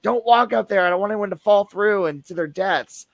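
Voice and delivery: mock-urgent call, pitched well up; urgent retold warning. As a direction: imitate warning people off in alarm. Retelling own warning to visitors.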